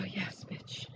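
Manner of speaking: breathy, voiceless mock triumph; whispered yes. Mood board makes you go, a whispered yes bitch. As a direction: hiss it like a private victory. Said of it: "makes you go".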